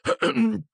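a high, nervous ahem; nervous throat clearing. A narrator's reading. Mr Dursley clearing his throat before asking his wife. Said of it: "Si schiarì nervosamente la voce".

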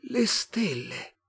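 high, very slow alien voice; otherworldly, drawn-out wonder; Martian. A narrator's reading. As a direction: echo it in a stretched, high Martian voice. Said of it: "disse il marziano".

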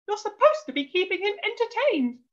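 high-pitched scolding; shrieking wife. A narrator's reading. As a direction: tell off your husband shrilly. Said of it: "she shrieked at her husband".

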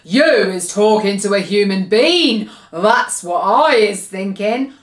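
loud, accusing giant voice; big bullying giant's boom. A narrator's reading. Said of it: "he boomed".

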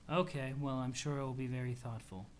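low, soft, unmoved reply; understated, polite indifference; lightly voiced deadpan Aradia. Aradia answering about a surprise present, lightly deadpan.

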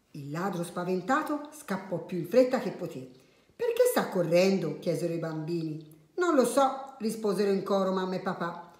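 soft storyteller with lively character lines; children's story narration. A narrator's reading. Adult reading a funny tale to children, animated storytelling. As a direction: read it gently, acting out each line.

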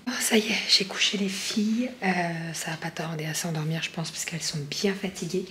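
chuchotement tout bas; chuchoté. Real, not acted. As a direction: murmurer pour ne pas réveiller. Girls just put to bed. (elle chuchote, les filles viennent de se coucher)

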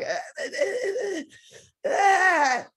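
high, stuttering fake sob rising to loud wail; hammed-up whimper building to a bawl; mock crying. Actress showing her director she is trying to cry, mock sobbing.

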